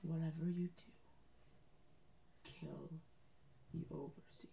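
hushed, measured secret order; whispered command. Acted. Mysterious figure gives the champion a secret order, whispering. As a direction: drop to a near-whisper on the later words, pausing between phrases. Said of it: "then whispers into your ear".

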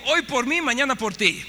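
barrio tough talk. Retold or imitated. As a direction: throw it at her like the barrio. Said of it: "me salió el barrio".

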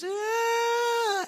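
retelling a waitress screaming and weeping; imitated scream. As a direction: let out a sharp wailing cry like she's overcome. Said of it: "She started to scream".

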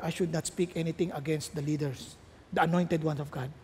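low, soft, chastened admission; suddenly quiet. Acted. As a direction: back down meekly after an outburst. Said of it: "Tahimik na kagad". (biglang tahimik at mapagkumbaba)

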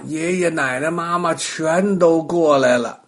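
plain reading voice

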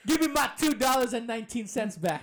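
loud, high promo shout; wrestling promo demand. Pretend. Continues the mock promo demand.

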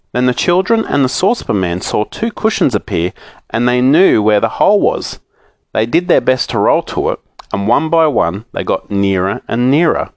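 usual speaking voice

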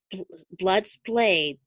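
usual speaking voice

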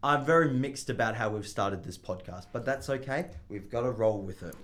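low, steady audiobook reading; story narration. Acted. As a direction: read it like a calm audiobook. Reading the novel aloud.

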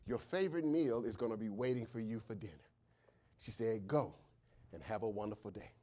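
soft, low, fast story narration; quiet storytelling. A narrator's reading. Preacher telling the congregation a story, hushed. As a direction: draw them in with a hushed tale.

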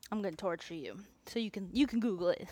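ordinary talk, nothing marked

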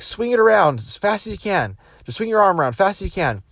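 seminar leader. Retold or imitated. Tony Robbins commanding the crowd.

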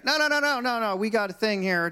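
high rapid string of objections; flustered refusal. Retold or imitated. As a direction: cut in to refuse, pitch jumping up. Other salesman acted refusing.

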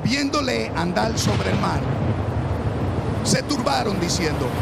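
loud, high, fervent Bible reading; preaching at full force. Acted. Preacher reads to the church, loud and high-pitched. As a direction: preach the storm story to the congregation. Reads the storm scene aloud.

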